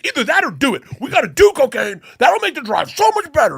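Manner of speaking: a screaming inner voice freaking out about jail; shrill-free high yelling panic in your head; panicked anxiety voice. Retold or imitated. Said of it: "this fucking anxiety voice goes".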